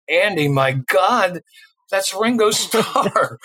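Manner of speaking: slow, high, awed; hushed amazement, slowed right down; starstruck. Retold or imitated. Starstruck whisper to friend.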